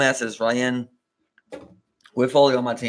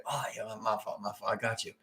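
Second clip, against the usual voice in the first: soft, dazed mumble, much softer; mumbled apology; 5 semitones lower, 14 dB quieter than usual. Retold or imitated. Teammate mumbles an apology, dazed and soft. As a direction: mumble it like you're spaced out. Retelling Foley's dazed reply.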